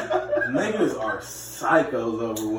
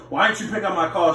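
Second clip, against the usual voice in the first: higher, indignant demand; raised voice; 4 semitones higher than usual. Acted. Man confronting girlfriend about a missed call, raised voice. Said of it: "then you might raise your voice".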